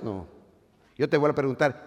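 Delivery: ordinary talk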